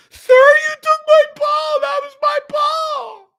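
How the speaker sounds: high, very slow wailing shout; mocking Queen Karen. Vlogger mocking Karen demanding her ball back, high shrill wail. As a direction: drag out a shrill high entitled whine. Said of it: "Queen Karen".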